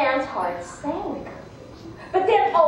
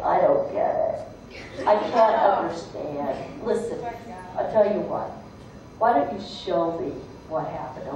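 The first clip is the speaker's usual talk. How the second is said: playing dumb; 8 semitones lower than usual. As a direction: act innocently puzzled. Fox feigning confusion.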